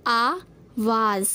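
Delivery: आवाज़ drawn out very slowly, nukta z clear at the end; a slow, careful आवाज़ with a falling close; correct pronunciation. Shown as a demonstration. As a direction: stretch out आवाज़, landing the final z. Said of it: "इसका शुद्ध रूप होता है".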